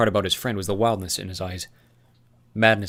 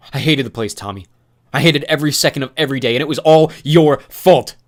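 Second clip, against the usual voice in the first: louder, higher bitter confession; anguished accusation; 5 semitones higher, 12 dB louder than usual. Acted. Newt confessing his despair to Thomas, anguished and loud. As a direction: blame him through pain.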